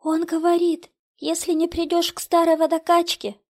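put-on high, loud, frightened girl's voice; Катя, sobbing. A narrator's reading. Daughter over radio passing on the threat, high frightened plea. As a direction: relay the threat in terror. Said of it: "Рыдания Кати смешались с хрипом".

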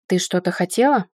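impolite. A narrator's reading. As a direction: ask it curtly, a little low and firm. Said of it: "спросил он не самым вежливым тоном". (не самым вежливым тоном)

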